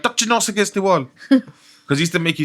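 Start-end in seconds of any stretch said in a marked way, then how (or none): 0.0-1.1 higher-pitched mock order; science teacher imitation. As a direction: order a pupil like a strict teacher. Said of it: "he's like".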